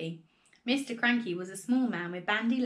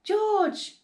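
high, loud, eager call; urgent call; 12 semitones higher, 6 dB louder than usual. A narrator's reading. Father calling his son, high urgent voice.

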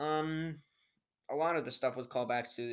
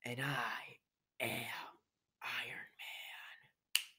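slow, hushed, whispered ending; Iron Man line; 4 dB quieter, much slower than usual. Retold or imitated. Kid quoting Tony's final line, slow hushed whisper. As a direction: breathe the last words slowly. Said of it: "the one line".

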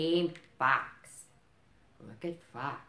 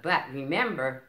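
ordinary delivery

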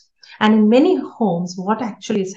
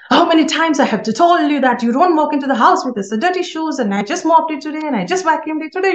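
put-on high, loud, rushed scolding of the child; fast, loud yelling; 7 semitones higher, 4 dB louder, faster than usual. Shown as a demonstration. Mom yelling at child over dirty shoes on carpet, fast and loud. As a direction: nag at the kid full blast, rapid-fire. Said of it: "it's a fast, fast, fast, loud and loud".

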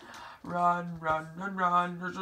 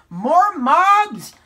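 high, loud, drawn-out protest; exasperated shout; 11 semitones higher, 12 dB louder, much slower than usual. Real, not acted. Player surrounded by mobs, exasperated shout. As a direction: yell it as a fed-up plea.

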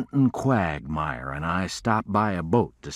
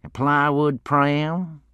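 high, slow disdainful echo; barely hide your contempt, slowly; a touch of a sneer held back; suppressed sneer; 10 semitones higher, 5 dB louder, slower than usual. Acted. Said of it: "struggling to hold back a sneer".

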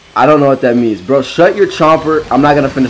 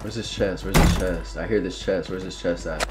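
muttered to self; 8 semitones lower, 13 dB quieter than usual. Real, not acted.

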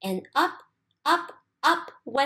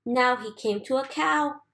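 ordinary delivery

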